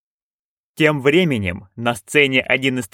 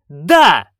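loud shout; 4 semitones higher, 6 dB louder than usual. A narrator's reading. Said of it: "witches shout yes".

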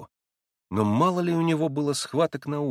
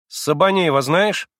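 slow, louder, stern demanding question; sterner, more insistent; 6 semitones higher, 6 dB louder, slower than usual. Acted. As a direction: press him even harder. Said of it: "ещё настойчивее, ещё строже".

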